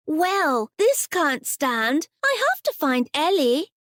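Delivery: put-on a small girl making up her mind; resolute little girl. Little girl deciding to find her doll, mildly resolute. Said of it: "stamped her foot resolutely".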